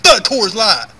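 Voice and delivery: loud disgusted shout; angry outburst. Acted. Drunk man ranting at cheap beer, loud angry shout.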